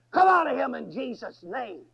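loud exorcism command; real forceful. Retold or imitated. Preacher commanding a spirit to leave Robert, real forceful. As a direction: burst out forcefully, ordering the spirit out. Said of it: "I said that real forceful".

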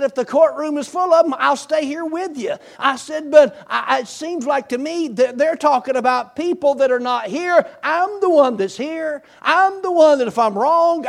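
rising courtroom appeal, voice climbing; impassioned plea. Retold or imitated. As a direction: stand up and argue your own case hard. Retold plea before the judges.

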